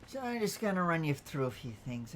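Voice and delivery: usual speaking voice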